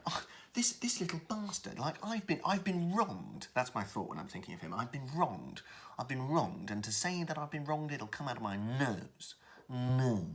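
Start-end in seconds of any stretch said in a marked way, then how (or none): 0.1-3.5 high-pitched wounded indignation; affronted whine, much higher. Shown as a demonstration. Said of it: "make him more affronted". 4.7-10.2 nasal, affronted brother; higher, snide nasal complaint; through the nose. Shown as a demonstration. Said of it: "talk through the nose".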